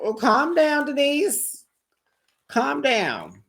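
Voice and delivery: a high-pitched retold put-down; with her whole chest. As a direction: tell her to calm down with full conviction. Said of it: "She said that with her whole chest".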